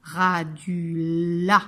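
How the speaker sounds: "Radula" very slow, loud, split ra-du-la; syllable-by-syllable. Shown as a demonstration. Teacher pointing out the last syllable, "Radula" slow and split. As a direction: break "Radula" into long separate syllables. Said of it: "dernière syllabe".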